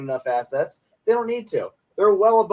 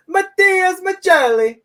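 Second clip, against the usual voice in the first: hammed-up Italian accent on Matias Maccelli; higher, louder Italian-accented Matias Maccelli; fake Italian accent; 8 dB louder, slower than usual. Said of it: "Go on, go on, Robin. Say".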